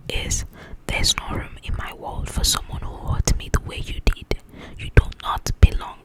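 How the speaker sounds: ordinary talk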